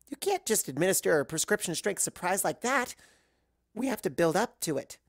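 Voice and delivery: a hyper pony taking charge; bubbly insistent cut-in. Acted. Pinky cuts in loudly.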